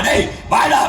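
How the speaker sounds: shouting "டேய், வாடா" to a man in the sea; mock calling out. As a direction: yell down from the helicopter. Helicopter rescuer calling the drowning man.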